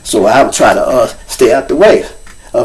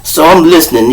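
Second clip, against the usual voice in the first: normal talking voice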